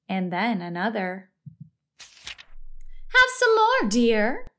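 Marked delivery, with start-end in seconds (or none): 2.0-4.5 high, slow sing-song coaxing; sleep-talking wife. A narrator's reading. Wife dreaming of buttering toast, high dreamy coaxing. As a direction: offer it high and drawn out, dropping on "dear". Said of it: "Missus Watson said in her sleep".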